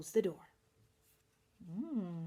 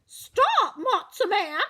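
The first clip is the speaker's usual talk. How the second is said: very high squawky hen cry; clucking hen; 10 semitones higher, 7 dB louder, slower than usual. Acted. Red hen clucking after the matzah man. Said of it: "clucked the red hen".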